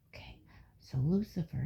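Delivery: ordinary delivery, nothing marked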